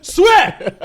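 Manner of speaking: high, loud roared shout. Retold or imitated. Dragon Ten roaring at Varys: high, loud shout. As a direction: bellow the one word as the dragon.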